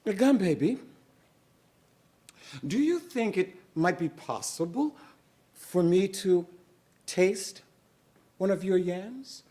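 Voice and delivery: high, sugary coaxing voice; butter up the gum baby for yams; sweet-talking Moesha. Acted. Moesha coaxing the gum baby, sweetly.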